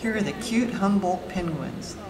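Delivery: normal talking voice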